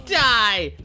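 mock attack yell. Player attacking Grandpa in the game, loud mock rage. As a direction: yell it as you strike.

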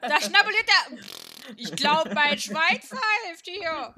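high, loud excited voice. Real, not acted. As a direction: react loud and amazed. Excited game reaction.

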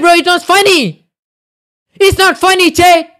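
high-pitched angry warning; mad ranting. Real, not acted. Streamer threatens to ban spamming chat, angry yelling. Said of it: "Everybody making me so mad".

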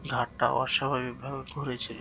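usual speaking voice

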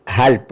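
"help" said halp, ending in p; final P sound. Shown as a demonstration. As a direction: demonstrate "help" as German halb. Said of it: "with P sound". ("help" അവസാനം പി ശബ്ദത്തോടെ)

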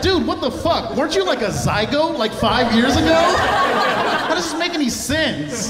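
loud, high, laughing disbelief; incredulous outburst. Retold or imitated. Teacher reacting to the bragging kid, loud disbelief. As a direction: blurt it out, stunned and laughing. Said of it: "And I was like".

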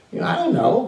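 high, flippant dodge; a sarcastic shrug of an answer; smart-alecky Cain. Acted. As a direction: brush God off like a cheeky kid. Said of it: "You get smart with God".

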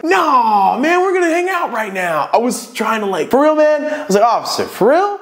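frantic high-pitched plea; high desperate plea. Retold or imitated. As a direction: beg the officer in disbelief. Pleading with cop for friend.

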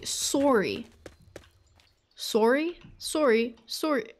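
SORRY repeated as Canadian "sore-ee". Retold or imitated. Quoting characters from a Canadian show, Canadian SORRY. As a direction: try out the Canadian rounded vowel on SORRY. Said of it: "but they say".

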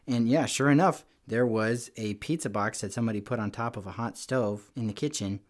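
ordinary delivery

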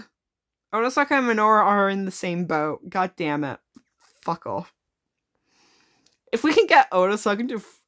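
ordinary delivery

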